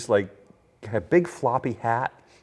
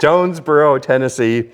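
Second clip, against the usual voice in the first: louder, firm restatement; mock certainty; 4 semitones higher, 8 dB louder than usual. Real, not acted. Restating the town name to her, louder and firmly certain. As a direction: say it like it's final. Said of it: "I'm sticking with it".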